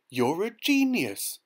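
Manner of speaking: high, slow, delighted praise; excited cry. A narrator's reading. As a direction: burst out in amazed admiration. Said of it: "I cried".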